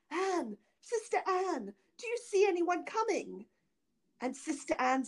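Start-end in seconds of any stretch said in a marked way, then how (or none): 0.1-3.5 afflicted calling out. A narrator's reading. Afflicted wife calls to her sister on the tower, anxious high cry. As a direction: call up to a sister in hope of rescue. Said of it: "the poor afflicted wife cried out".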